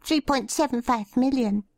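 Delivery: high, reedy shy man. A narrator's reading. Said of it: "a reedy girl-boy voice called out".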